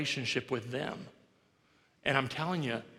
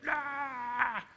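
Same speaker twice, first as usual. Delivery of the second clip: retold wail; 11 semitones higher than usual. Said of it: "she's just like".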